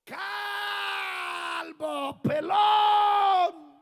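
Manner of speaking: very loud, high, drawn-out taunt; jeering yell. Acted. Boys yelling at the bald prophet, mocking holler. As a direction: yell it out long like a heckler. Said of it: "A ver cómo le gritaban".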